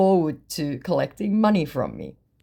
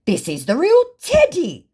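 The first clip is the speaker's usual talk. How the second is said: high, louder indignant claim; hammed-up fake celebrity; mock scammer voice; 13 semitones higher, 6 dB louder than usual. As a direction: insist in a silly put-on voice.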